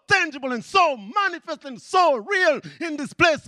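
high, loud fervent preaching; preaching at full force. Acted. Preacher proclaiming believers are God's temple, shouting. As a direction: lift the congregation up.

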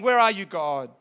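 ordinary talk

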